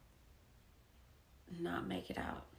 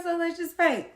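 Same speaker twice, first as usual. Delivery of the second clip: higher, louder cheerful neighbor; neighbor lady; 9 semitones higher, 9 dB louder than usual. Retold or imitated. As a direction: invite her to paint.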